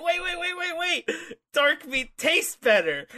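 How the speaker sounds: high-pitched frantic waits, then bargaining; mock panicked plea. Gene Money redirects zombies to Sugar, mock panic. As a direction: plead to be spared, hammed up high.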